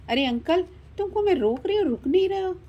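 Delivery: high-pitched, impatient protest; exasperated calling. Acted. Stopping a seller who walks on. (ऊँचे सुर में झुँझलाकर टोकना)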